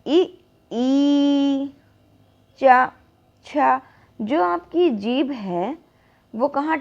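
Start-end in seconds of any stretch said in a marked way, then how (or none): 0.1-3.8 ई held, then चा and छा crisp from the palate; tongue on the palate for ई, चा, छा; palatal demo. Shown as a demonstration. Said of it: "अब इसको बोलिए".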